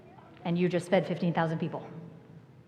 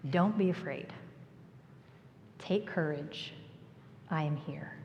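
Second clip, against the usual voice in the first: gentle reassurance; 4 semitones lower, 8 dB quieter than usual. Retold or imitated. Jesus' calming words.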